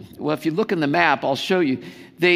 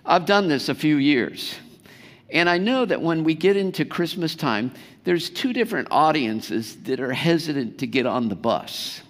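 ordinary talk

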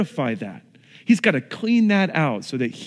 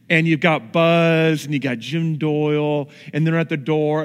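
normal talking voice

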